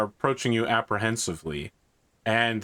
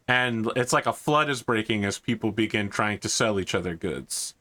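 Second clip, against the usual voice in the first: usual speaking voice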